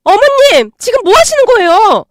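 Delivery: high, loud shocked cry; shocked shriek. Acted. Sister-in-law shrieking at mother-in-law, shocked and loud. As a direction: scream out in outrage.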